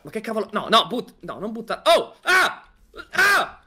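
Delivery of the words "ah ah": high, short yelps of alarm; high mock screams. Player panicking at something thrown, short high yelps. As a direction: squeal like you are being attacked.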